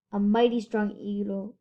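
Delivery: usual speaking voice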